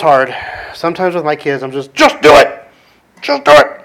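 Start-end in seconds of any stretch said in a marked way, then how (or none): 1.9-3.8 loud high barked order, said twice; impatient command. Retold or imitated. Dad ordering his kids without explaining, loud impatient command. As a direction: bark it at the kids, fed up.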